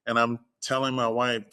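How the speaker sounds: normal talking voice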